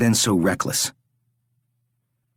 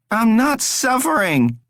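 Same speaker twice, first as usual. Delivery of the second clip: high, slow, forced denial; strained denial. A narrator's reading. Rory denies his suffering to his captor, strained. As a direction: push the words out through a strained smile.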